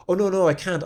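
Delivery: high, loud refusal; flustered protest. Retold or imitated. Actor refusing the assistant director's summons, flustered protest.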